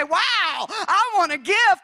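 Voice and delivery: high, loud, slowed wanting; hammed-up childlike excitement; mock eager delight. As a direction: act out a churchgoer grabbing for the gift. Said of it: "everybody would say".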